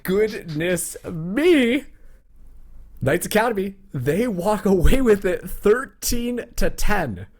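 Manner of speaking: high, loud, slow victory call; excited. Real, not acted. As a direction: announce the winner with full hype. Caster calls the match win.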